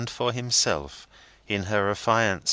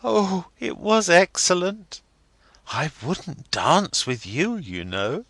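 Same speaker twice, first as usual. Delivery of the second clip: put-on young girl Rosa; 11 semitones higher, 3 dB louder than usual. A narrator's reading. Rosa voiced in story.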